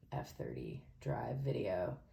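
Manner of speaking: unvoiced whisper aside. Real, not acted. Vlogger whispering an aside to viewers, hushed. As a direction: breathe the words out with no voice.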